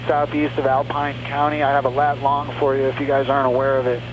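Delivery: usual speaking voice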